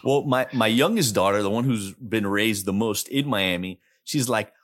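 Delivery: normal talking voice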